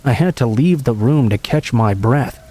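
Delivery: usual speaking voice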